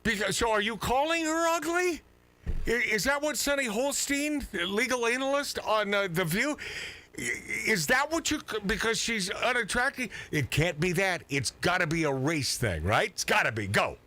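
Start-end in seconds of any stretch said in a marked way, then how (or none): 0.0-10.1 incredulous questioning. Real, not acted.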